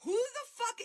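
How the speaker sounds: high-pitched disbelieving burst; incredulous outburst. Real, not acted. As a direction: fire it off in outraged disbelief. Furious outburst at a rumour.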